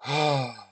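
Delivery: long relieved exhale; sigh of relief. Acted. Family relaxing after the tantrum, relieved sigh. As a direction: let out a deep relieved breath. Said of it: "take a deep breath of relief".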